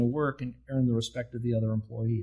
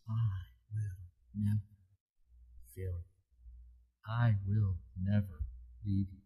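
tender promise; 4 semitones lower, 8 dB quieter than usual. Acted. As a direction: speak it gently like a comfort to the hurting. Tender close, God's promise.

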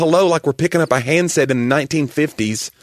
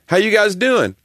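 radio caller; 9 semitones higher, 3 dB louder than usual. Retold or imitated. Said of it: "calls a radio show".

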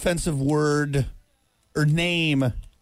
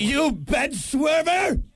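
mock insult shout; 8 semitones higher, slower than usual. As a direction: hurl it like a Tudor curse. Acting out old insults.

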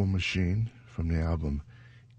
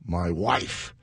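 ordinary talk, nothing marked

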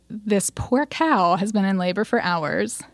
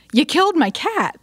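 high-pitched, mock-outraged cry; retold outrage, pitched up high; mock accusation; 9 semitones higher than usual. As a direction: burst in accusing, half in fun. Owner bursts in, accusing the vet.

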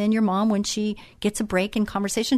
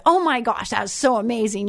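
gushing praise; 2 semitones higher than usual. Retold or imitated. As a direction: make a happy fuss over the kids. Repeats her gushing praise to boys.

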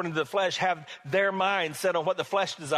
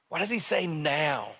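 ordinary talk, nothing marked

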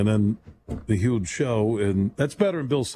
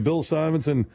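ordinary delivery

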